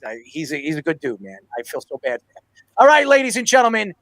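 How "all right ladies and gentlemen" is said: big announcer call, higher and louder; hyped show host announcing; announcer intro. Acted. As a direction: open the segment like a ring announcer. Announcing segment.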